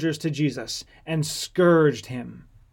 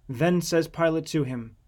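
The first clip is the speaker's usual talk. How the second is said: ordinary delivery, nothing marked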